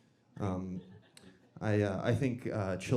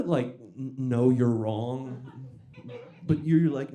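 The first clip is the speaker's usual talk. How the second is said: ordinary delivery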